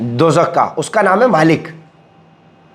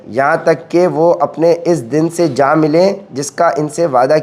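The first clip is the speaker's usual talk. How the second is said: measured, even recitation of translated scripture; scripture reading; 6 semitones lower than usual. Acted. Preacher reading God's words to listeners, formal scripture reading. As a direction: read it out formally, in an even lower register.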